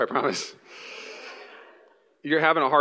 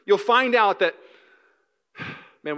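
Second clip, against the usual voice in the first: normal talking voice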